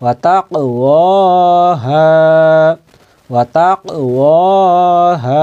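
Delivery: ওয়া তাক ওয়া হা slowly, holding the second ওয়া and the হা; drawn-out long vowels. Shown as a demonstration. Teacher showing learners the madd, long vowels drawn out. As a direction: stretch both vowels after তাক, ওয়া and হা. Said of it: "আলিফ মাদ্দা এসে টানতে হবে".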